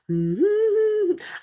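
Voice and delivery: high wordless sung hum; idle, high little croon, imitated; singing to herself. Storyteller shows how she sat singing to herself, high hum. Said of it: "I just started singing to myself".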